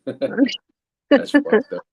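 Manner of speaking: high squealing laugh through words. Real, not acted. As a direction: crack up while saying it. Laughing at comment.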